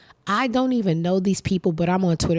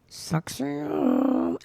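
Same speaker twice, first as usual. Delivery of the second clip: slow taunt; 7 semitones higher, 5 dB quieter, much slower than usual. Retold or imitated. Taunting new girlfriend.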